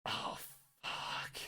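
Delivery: a horrified whisper to yourself; whispered curse on seeing him; whispered dread. Retold or imitated. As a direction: breathe it out under the breath.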